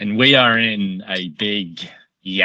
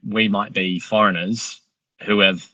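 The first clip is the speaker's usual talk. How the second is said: ordinary delivery, nothing marked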